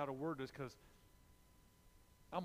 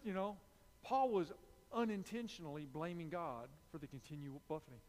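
normal talking voice

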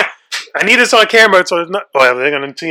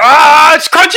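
loud, high panicked cry; disgusted yelp; 10 semitones higher, 7 dB louder, slower than usual. Real, not acted. Bites handful of bugs.

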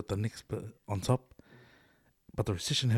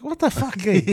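disbelief; 11 semitones higher, 9 dB louder than usual. Real, not acted. Outraged at yellow sky.

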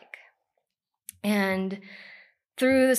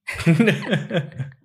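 embarrassed laugh; 3 semitones lower, 11 dB louder than usual. Real, not acted. Laughs at not reading.

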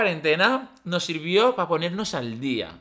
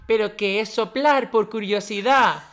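put-on higher little-kid voice; baby voice; 5 semitones higher than usual. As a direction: say it like a small child. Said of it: "con voz de niño pequeño". (voz de niño pequeño)

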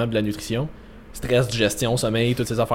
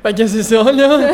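laughing; 8 semitones higher, 5 dB louder, slower than usual. Real, not acted. Hosts laughing at their digression.

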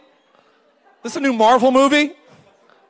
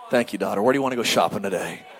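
much lower, relaxed fatherly reply; deep dad voice; 14 semitones lower, 4 dB quieter, faster than usual. Retold or imitated. Father answering his daughter's casual chat in a deep voice. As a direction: drop way down like a pleased dad.

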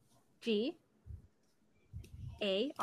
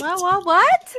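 loud, startled burst of whats; baffled; 9 semitones higher, 12 dB louder, slower than usual. Real, not acted. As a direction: blurt it out in loud disbelief. Baffled by the spelling word.

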